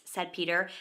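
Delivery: ordinary delivery, nothing marked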